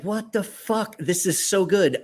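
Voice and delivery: high-pitched stunned exclamation; amazed outburst. Retold or imitated. Trying the knife, he exclaims in high amazement. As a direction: blurt it out, floored by how good it is.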